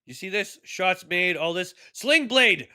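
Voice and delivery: hyped live play-by-play call; sports announcer. Acted. Calling the final plays.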